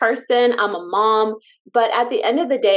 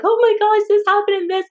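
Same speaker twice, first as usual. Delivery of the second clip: much higher, louder, gushing; retold excitement; 12 semitones higher, 5 dB louder than usual. Telling her husband about new testimonies, squealing with excitement. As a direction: gush like news just arrived. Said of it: "I'm like".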